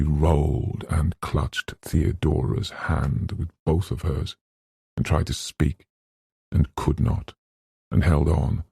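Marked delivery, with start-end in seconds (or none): none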